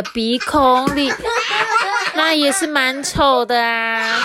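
slow, laughing through the words. Acted. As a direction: crack up mid-sentence at the silly picture. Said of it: "你们笑得太夸张了吧". (边笑边慢慢说)

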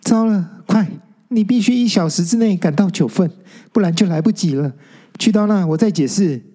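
put-on high, hurried warning of a ghost girl; nervous, urgent. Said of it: "紧张地说". (高声紧张地催促)

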